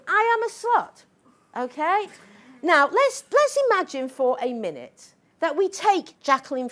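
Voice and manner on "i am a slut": high, sarcastic echo of the slogan; mock the slogan, pitched up. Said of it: "this is feminism".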